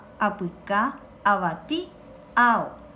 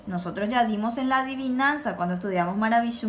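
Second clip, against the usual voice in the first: ordinary talk, nothing marked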